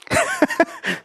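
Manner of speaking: sudden high-pitched giggle; high laugh. Real, not acted. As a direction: break into a light giggle. Students joke, teacher laughs.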